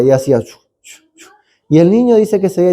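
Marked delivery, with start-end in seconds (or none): 0.4-1.3 hushed "chu" bursts; repeated whispery sound effect; imitated turbulence noise. Shown as a demonstration. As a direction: mimic jolts with soft whooshes. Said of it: "Y hace así".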